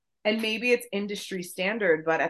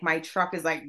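ordinary talk, nothing marked

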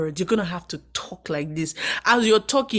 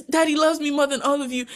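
taunting favourite son; 7 semitones higher, faster than usual. Retold or imitated.

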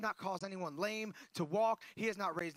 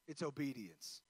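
ordinary delivery

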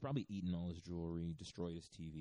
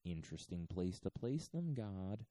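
ordinary delivery

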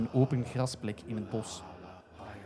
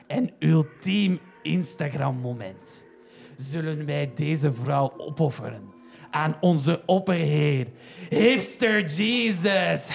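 trage, plechtige sektepreek met gelach; kwaadaardige sekteleider; slower than usual. Acted. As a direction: preek het offer plechtig aan. Opperhipster leidt het ritueel. (opperhipster die zijn volgelingen plechtig toespreekt)

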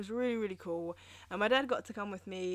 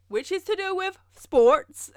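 slow, higher and louder, drawn out; mock pointed emphasis; 9 semitones higher, 8 dB louder, slower than usual. Joking to listeners she knows nothing of sports, slow mock emphasis. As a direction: lean on it like a teasing punchline. Running joke, sports ignorance.

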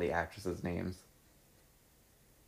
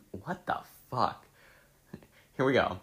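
ordinary talk

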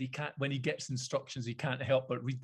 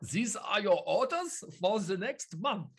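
higher-pitched mock German accent; 7 semitones higher, slower than usual. Joking bank memo to chief execs, mock German accent. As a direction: give orders in a hammed-up German accent. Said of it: "I just did it in a German accent".

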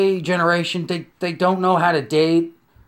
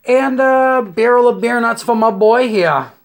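higher, louder proud demand; loud order; 6 semitones higher, 5 dB louder than usual. Retold or imitated. Ordering for the elephant, loud and proud. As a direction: call it out big.

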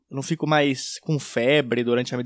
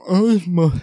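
slurred speech; 5 dB louder, slower than usual. Retold or imitated. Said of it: "tava falando muito errado".

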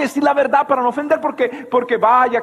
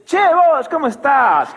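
bright, higher Argentine "Che, vos" greeting; phone woman's Argentine hello, higher and louder; Argentine caller; 4 semitones higher, 4 dB louder, slower than usual. Retold or imitated. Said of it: "no es un problema de la Patagonia".

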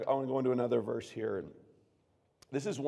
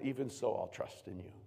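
quiet trust; 3 semitones lower, 8 dB quieter than usual. Acted. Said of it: "He always ended his Psalms that".

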